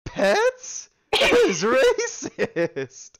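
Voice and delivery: high, drawn-out indignant squeal; mock outrage. Real, not acted. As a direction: play up being offended.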